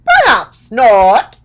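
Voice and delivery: loud, slow, high Scarecrow voice; Scarecrow's retort. A narrator's reading. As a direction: draw it out big and bold as the Scarecrow. Scarecrow's line in read story.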